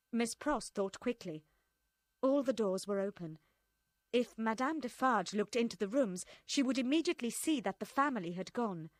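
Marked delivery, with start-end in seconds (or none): none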